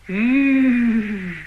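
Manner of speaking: drawn-out whoa to the horse; horse-stopping call. Acted. 勒住战马. (勒马时喊的一声吁)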